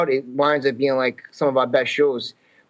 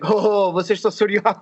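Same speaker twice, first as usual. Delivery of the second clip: lightly imitated Portuguese speaker, higher, amused recognition; Joaquin Almeida; 5 semitones higher than usual. Actor pegging the visitors as Azorean, lightly mimicked. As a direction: mimic a man placing their origins. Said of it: "we met Joaquin Almeida in Canada".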